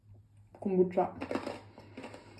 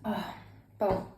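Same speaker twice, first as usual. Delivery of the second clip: speaking between hiccups; interrupted by a hiccup. Real, not acted. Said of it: "Ah, j'ai le hoquet".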